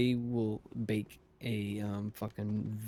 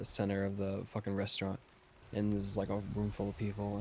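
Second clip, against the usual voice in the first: normal talking voice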